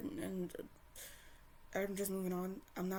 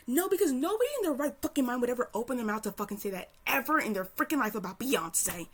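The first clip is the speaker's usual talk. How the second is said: loud and fast, swearing; fast fuming tirade; angry rant; 4 semitones higher, 8 dB louder than usual. Real, not acted. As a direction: rattle it off in a furious tirade. Furious rant about Billie fans.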